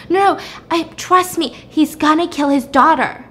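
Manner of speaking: retold urgent plea. Wife pleads with angry husband.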